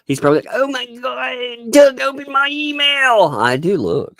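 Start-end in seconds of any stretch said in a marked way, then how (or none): 0.5-3.3 much higher, slow, rising to a shout; whiny mock plea. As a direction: beg like a needy pest, drawn out. Imagining Volpe pleading to Doug.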